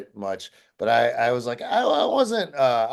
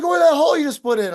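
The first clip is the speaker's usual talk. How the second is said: fast, high, loud outburst; angry shout; 7 dB louder, faster than usual. Retold or imitated. Manager to guitarist about the wrecked wall, fast angry shouting. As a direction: imitate a furious manager in a rush of words.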